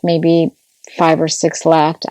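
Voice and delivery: usual speaking voice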